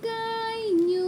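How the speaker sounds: high, long-held sing-song; sing-song all done. Real, not acted. Parent telling toddler it is finished, high sing-song.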